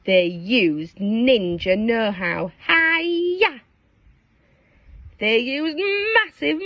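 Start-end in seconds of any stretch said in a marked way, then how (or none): 2.7-3.6 karate shout. Acted.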